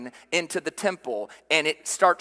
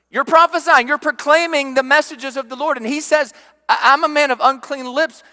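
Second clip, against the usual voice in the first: higher, louder, urgent; raised preacher's voice, sustained intensity; impassioned preaching; 8 semitones higher, 6 dB louder than usual. Real, not acted. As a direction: burst out and drive the point home. Preacher surging on prophet's unclean lips.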